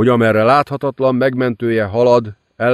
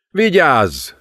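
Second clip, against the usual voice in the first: sharp, higher warning call; warning shout; 6 semitones higher than usual. A narrator's reading. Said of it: "kiáltotta Klippel". (figyelmeztető kiáltás)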